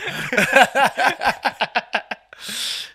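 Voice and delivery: high burst of laughter; loud laugh. Real, not acted. Big laugh after fumbling English.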